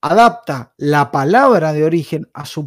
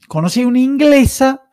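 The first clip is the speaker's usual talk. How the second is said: conocí una inglesa lifted much higher; high repeat; 8 semitones higher than usual. Shown as a demonstration. Retelling a documentary to friends, repeats it pitched high. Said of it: "Y conocí una inglesa".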